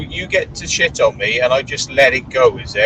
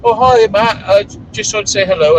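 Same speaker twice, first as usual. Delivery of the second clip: high, loud sugary greeting; high loud mock-sweet mimicry of someone who wronged him; mocking fake-nice voice; 9 semitones higher, 7 dB louder than usual. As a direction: ham up the fake friendliness. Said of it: "in a pub and go".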